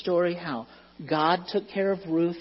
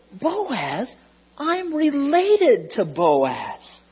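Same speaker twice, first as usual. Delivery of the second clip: put-on excited old woman, much higher and louder; higher, slowed, amazed exclamation; shocked Naomi; 9 semitones higher, 4 dB louder than usual. A narrator's reading. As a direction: Naomi bursting with the good news. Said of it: "was shocked and said".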